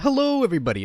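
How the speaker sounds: big showy announcer hello; reworked intro inflection. Shown as a demonstration. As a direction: pitch the greeting way up like a host. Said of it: "maybe the problem I have with it now is my inflection".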